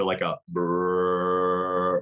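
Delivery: long, low rolling brrr grunt; drawn-out deer grunt. Shown as a demonstration. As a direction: imitate a buck's grunt, drawn out. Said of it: "I like to do".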